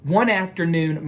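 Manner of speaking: ordinary delivery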